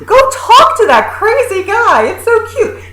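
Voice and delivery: high-pitched gushing mock parent. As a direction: coo loudly at the kids, up high. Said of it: "parents are like".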